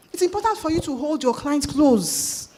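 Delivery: normal talking voice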